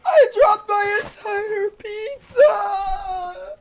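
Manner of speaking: long, high, stretched-out wail; anguished shouting. Real, not acted. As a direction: yell in real dismay. Dropped his whole pizza.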